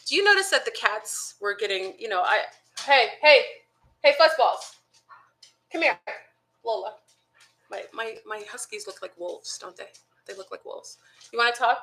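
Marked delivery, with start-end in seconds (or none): 2.8-6.9 calling the dogs. Real, not acted. Calling her dogs over. 10.3-11.0 whispered aside. Real, not acted. Aside about her huskies.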